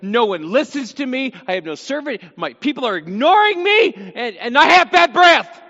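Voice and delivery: high, loud hammed-up wail of complaint; wailing Job. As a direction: whine it out like a man who has lost everything. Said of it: "he's, like, wailing".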